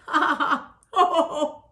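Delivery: hearty ha-ha-ha into ho-ho; performed belly laugh; acted guffaw. A narrator's reading. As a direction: laugh out big, storybook style. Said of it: "He giggled and guffawed".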